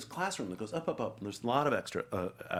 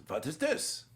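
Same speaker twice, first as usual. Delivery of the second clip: put-on higher German-accented question; Anthony Hopkins; 5 semitones higher, slower than usual. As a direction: ask pointedly in a German accent. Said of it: "he has this German accent".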